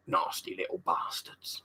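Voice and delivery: low, near-whispered grumble; very soft, sour character voice; imitated TV character. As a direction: hiss it quietly under your breath. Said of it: "I don't think he actually says that".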